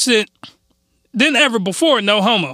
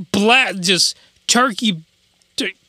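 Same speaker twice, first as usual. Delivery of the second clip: ordinary talk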